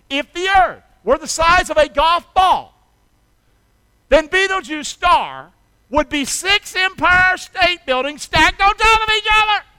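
loud, high, building preacher's call; full-force preaching. Acted. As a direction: drive the comparison home to the crowd.

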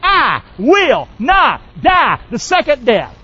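slow confident declaration. Shown as a demonstration. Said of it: "Can you say to me with confidence today".

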